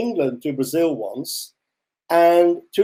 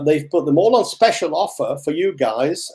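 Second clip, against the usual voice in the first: ordinary talk, nothing marked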